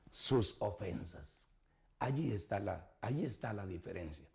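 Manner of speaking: hushed, confiding close; quiet weighty aside; low soft emphasis. Acted. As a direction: drop low and soft on the point. Preacher drops voice for emphasis.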